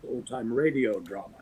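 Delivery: ordinary talk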